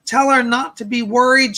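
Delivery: ordinary talk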